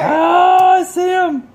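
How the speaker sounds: high, loud shout of alarm; startled shout. Real, not acted. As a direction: yelp at the sight. Spots the withers.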